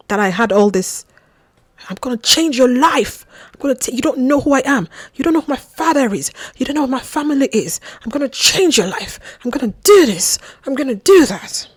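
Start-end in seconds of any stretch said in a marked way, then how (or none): 1.9-11.7 higher, fast, emphatic imitation of big promises; mock grandiose boasting. Mimicking a love-bombing suitor promising the world, mock boastful. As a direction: hammer out his boasts in a raised, mocking pitch.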